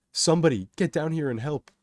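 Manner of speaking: loud, high call for help; yelling. A narrator's reading. Kato to the onlooking crowd, yelling for help. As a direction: yell out for anyone to help. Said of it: "Kato yells to the".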